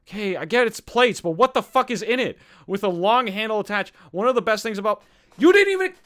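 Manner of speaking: exasperated rant climbing to a high, loud cry; worked up. Real, not acted. Host cannot find what is in the pie, worked up. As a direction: lose patience as it builds. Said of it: "I'm getting so worked up".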